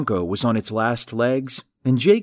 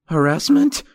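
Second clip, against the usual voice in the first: high, louder alarmed echo of Harassment; rising, startled Harassment, pitched up a notch; voice shooting up; 7 semitones higher, 6 dB louder than usual. Acted. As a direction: fling Harassment back up high in disbelief. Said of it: "His voice shot up a notch".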